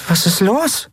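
high little puzzled question; confused. A narrator's reading. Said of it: "fragte Nelen verwirrt". (verwirrt gefragt)

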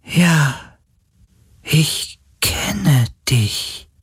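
high, very slow, frail spirit voice; dying creature. A narrator's reading. Dying sea spirit confirming it knows Galen, frail and slow. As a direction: draw each word out weakly, high-pitched. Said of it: "Die Stimme eines sterbenden Wesens".

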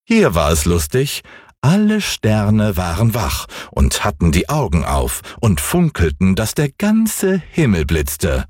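usual speaking voice

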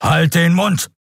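high, forceful snap; thundering. Acted. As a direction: shut her up hard. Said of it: "donnerte er".

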